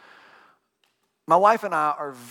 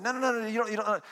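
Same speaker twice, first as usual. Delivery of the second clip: higher, quick insistent no no no; mock protest; 5 semitones higher than usual. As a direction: refuse her directions. Arguing with wife in car.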